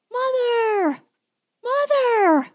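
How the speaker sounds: put-on high little boy's voice, drawn out and wailing; child screaming. A narrator's reading. Bobbity screams for his mother in the night, high wailing child's voice. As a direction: cry for your mother like a small boy. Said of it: "heartbreaking cry".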